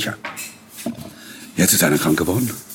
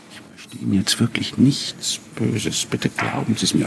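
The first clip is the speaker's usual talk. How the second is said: gentle reassurance; 3 semitones higher than usual. Retold or imitated. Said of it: "mit dem Ton".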